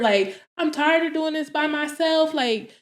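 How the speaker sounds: high, hurt lament; retold crying complaint. Daughter retelling how she cried to dad, high-pitched lament. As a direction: plead as if worn out, without real tears. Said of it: "Like, I'm just crying".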